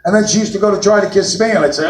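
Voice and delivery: ordinary delivery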